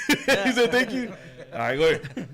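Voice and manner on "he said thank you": higher, louder, through laughter; laughing. Real, not acted. Laughs at friend's reply.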